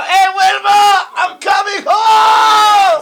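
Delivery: high, slow, loud drawn-out holler; Fred Flintstone call. Retold or imitated. Imitating Fred calling to Wilma, high slow holler. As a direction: bellow it like a cartoon caveman coming home. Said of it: "I'm like that vato".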